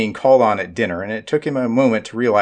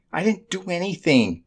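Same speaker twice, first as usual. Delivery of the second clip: protesting; 8 semitones higher than usual. A narrator's reading. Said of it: "Jer protested".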